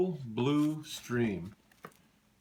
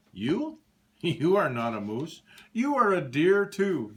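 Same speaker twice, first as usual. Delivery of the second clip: mocking with a laugh; 5 semitones higher, 4 dB louder than usual. Deer laughs at Morris, teasing and amused. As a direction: laugh at him as you say it. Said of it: "the deer laughed".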